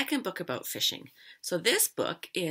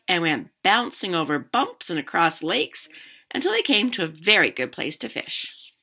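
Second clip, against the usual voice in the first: lively read-aloud, louder than chat; story narration; 3 semitones higher, 9 dB louder than usual. A narrator's reading. Teacher reading a fishing story to children, animated narration. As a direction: read a picture book out to children.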